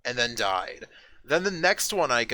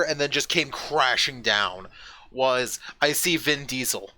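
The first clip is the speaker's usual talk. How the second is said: ordinary delivery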